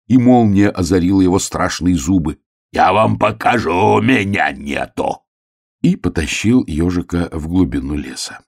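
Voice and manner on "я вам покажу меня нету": slow, growled threat; menacing wolf. A narrator's reading. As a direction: growl it with drawn-out vowels. Laughing wolf threatens his captive. (злобно рычит по-волчьи)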